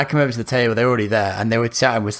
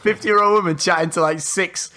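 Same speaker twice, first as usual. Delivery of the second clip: mock-incredulous retort, pitched up; incredulous comeback; 6 semitones higher than usual. Telling a friend how he called out cougaring, incredulous. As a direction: retell calling someone out in a higher, disbelieving voice.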